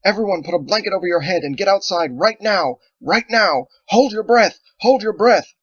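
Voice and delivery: high, loud urgent shout. Acted. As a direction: warn everyone at full voice.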